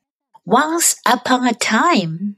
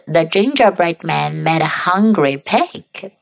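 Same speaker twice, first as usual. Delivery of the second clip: ordinary delivery, nothing marked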